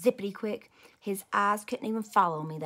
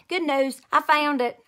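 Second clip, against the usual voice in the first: louder, higher nervous blurting; 7 semitones higher, 9 dB louder than usual. Acted. Junie B caught by Missus, nervously handing it over. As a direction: blurt it out fast to cover the fib. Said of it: "I said, very nervous".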